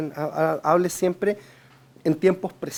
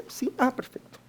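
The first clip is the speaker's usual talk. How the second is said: soft pleased reply; 5 semitones higher, 8 dB quieter than usual. Shown as a demonstration. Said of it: "puede hacer como".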